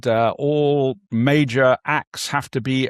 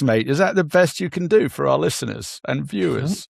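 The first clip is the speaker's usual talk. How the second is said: ordinary talk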